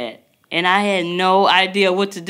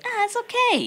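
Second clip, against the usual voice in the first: high, soothing friend voice; reassuring imitation; 4 dB quieter than usual. Mom imitating her friend's reassurance on the phone, high. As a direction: imitate a friend calming you down. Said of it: "you was like".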